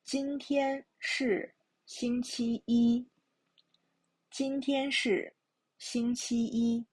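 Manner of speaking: "今天是星期一" in measured phrases, final "一" high and level; "星期一" ending on a sustained level tone; careful model reading. Shown as a demonstration. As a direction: model "今天是星期一" for learners, first-tone "一". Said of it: "Now listen to me".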